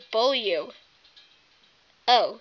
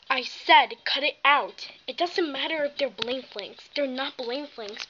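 normal talking voice

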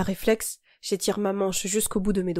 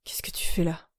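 hoarse voice. A narrator's reading. Young man found crying in the garage, hoarse. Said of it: "la voix rauque".

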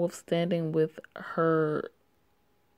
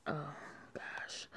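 whispered gosh; 17 dB quieter than usual. Real, not acted. Reacting under her breath.

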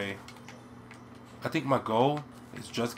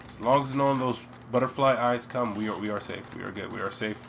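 ordinary delivery

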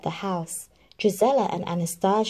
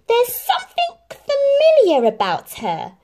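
much higher, louder suspicious stepmother voice; thoughtful stepmother; 9 dB louder than usual. Acted. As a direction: muse on it, half suspicious. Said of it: "said the stepmother thoughtfully".

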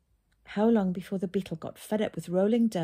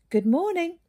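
bright, lilting greeting pitched high; musical voice; 11 semitones higher, 7 dB louder than usual. A narrator's reading. Hare greets Aubrey on the moor in a musical voice. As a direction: sing the greeting out high and light. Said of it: "said a musical voice".

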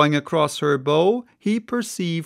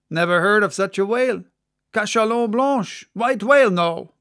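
fast, higher, dismissive; Guernsey mate; 5 semitones higher, 5 dB louder than usual. Acted. Guernsey mate denies knowing the whale, fast and dismissive. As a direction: brush it off quickly.

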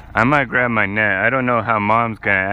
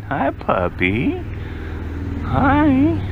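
puppy talk; much slower than usual. Real, not acted. Greeting a puppy softly.

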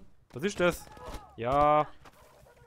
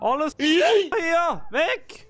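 high alarmed yell; panicked shout; 8 dB louder, much slower than usual. Real, not acted. Warning under attack.

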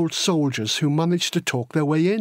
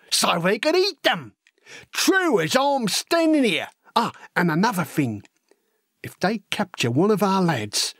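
lively, wide-eyed working-class lad spinning war tales; excitable storytelling with big pitch leaps; eager young Cockney recruit; 4 semitones higher than usual. Acted. Horace telling Elsie gruesome war stories, eager and excitable.

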